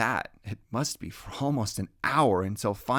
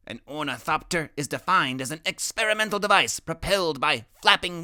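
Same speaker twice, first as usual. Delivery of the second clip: put-on boy genius lecturing; 5 semitones higher, 3 dB louder than usual. Boy genius explains his ornithopter to new friend, lecturing high. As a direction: recite it like a tiny professor.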